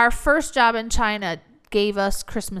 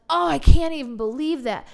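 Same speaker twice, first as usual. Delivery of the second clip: louder, mock-amazed gasp of disbelief; amazed principal; 5 semitones higher, 5 dB louder than usual.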